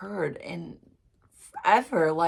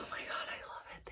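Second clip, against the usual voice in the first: awed whisper; 13 dB quieter, much faster than usual. Real, not acted. Awed reaction after the song.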